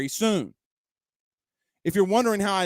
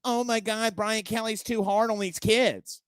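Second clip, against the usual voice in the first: higher-pitched mock whining; put-on protest, pitched up; mocking complainer; 3 semitones higher, 4 dB quieter than usual. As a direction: imitate a complaining critic, raised pitch. Mocking critics who complain about Kelly.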